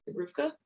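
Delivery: RVCA repeated softly as "Ruvka"; pronounced as one word. Shown as a demonstration. Repeating the brand name for the host, RVCA said softly as "Ruvka". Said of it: "it's pronounced Ruvka".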